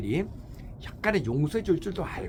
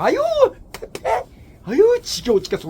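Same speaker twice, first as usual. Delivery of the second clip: nagging wife; 8 dB louder than usual. Retold or imitated. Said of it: "우리 남편 맨날 이렇게 욕이나".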